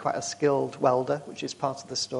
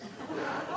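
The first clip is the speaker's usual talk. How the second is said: breathy laugh; 8 dB quieter than usual. Real, not acted. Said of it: "I don't know why I'm laughing".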